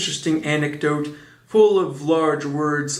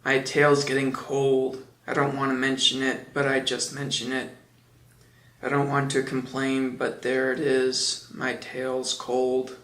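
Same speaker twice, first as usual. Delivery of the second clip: glum Eeyore; 3 semitones lower, 6 dB quieter than usual. A narrator's reading. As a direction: complain meekly, dragging the last words. Glum Eeyore complaining in the story.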